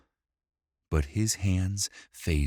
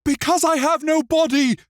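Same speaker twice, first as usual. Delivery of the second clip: put-on very high, loud child's scream; screaming boy; 9 dB louder than usual. A narrator's reading. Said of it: "Simon screamed".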